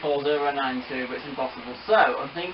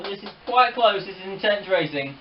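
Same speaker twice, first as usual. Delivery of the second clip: high, loud, building race call; racehorse commentator; 7 semitones higher, 4 dB louder than usual. Pretend. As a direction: call the finish like the races. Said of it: "talk like a racehorse commentator".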